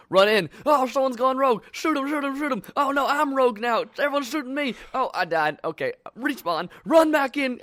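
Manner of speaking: high, loud frantic play calls; frantic stream player. Retold or imitated. Streamer calling out the chaotic match, high and frantic. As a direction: panic through the chaos. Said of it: "it seemed like it just".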